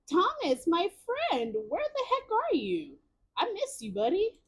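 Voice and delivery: high-pitched plea to a missing friend; playful pleading. Pretend. Streamer pining for the missing Thomas, playful high plea.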